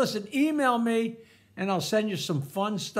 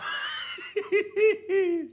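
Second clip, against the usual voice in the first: high giggle at own joke; laughing; 10 semitones higher than usual. Real, not acted. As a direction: giggle after the punchline.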